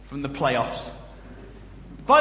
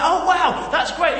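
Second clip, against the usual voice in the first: high, loud gushing wonder; mock awe; 7 semitones higher, 4 dB louder, slower than usual. Crowd mistaking preachers for gods, hammed-up awe. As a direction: gush like a wowed crowd, hammed-up. Awestruck crowd voiced in story.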